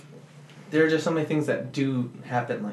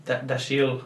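put-on Scottish accent; slower than usual. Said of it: "That's my best Scottish accent".

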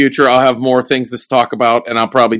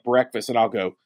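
normal talking voice

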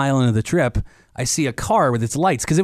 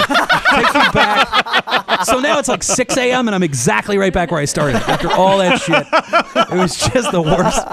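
laughing through the words; 7 semitones higher, 4 dB louder than usual. Real, not acted. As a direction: laugh as you tell it. Laughing through end of story.

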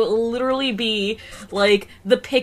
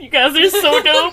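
higher, louder, laughing praise; gushing, laughing; 7 semitones higher, 6 dB louder than usual. Retold or imitated. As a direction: gush through a laugh.

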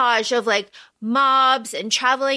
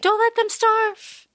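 husky Jennifer Lawrence; 8 semitones higher than usual. Retold or imitated. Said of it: "a very husky Jennifer Lawrence".

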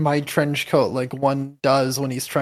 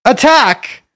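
loud, slow higher command; battle command; 8 semitones higher, 13 dB louder than usual. Acted. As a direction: send the bee in. Ordering bee to attack.

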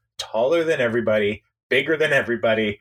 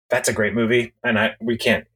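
ordinary talk

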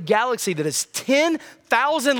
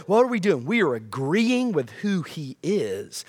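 normal talking voice

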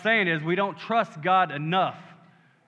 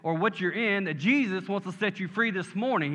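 normal talking voice

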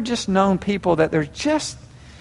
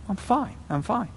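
very soft, higher-pitched mock denial; passive-aggressive; 5 semitones higher, 10 dB quieter than usual. Spouse dodging repeated questions, passive-aggressive. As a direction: brush off concern in a small, tight voice. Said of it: "demonstrate passive-aggressive behavior".

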